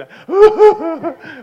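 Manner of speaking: high, loud mock bawling; imitated wailing sob. Newcomer fleeing across parking lot, imitated wailing. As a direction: keep up the hammed-up howl. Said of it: "and I'm sobbing".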